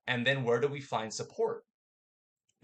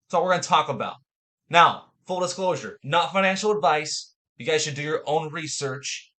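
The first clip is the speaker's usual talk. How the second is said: energetic show host; 10 dB louder than usual. Acted. Energetic show intro and disclaimer.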